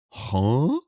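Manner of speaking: high goofy cartoon huh; squeaky cartoon dog yelp; cartoon character voice. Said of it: "it sounded like a cartoon character".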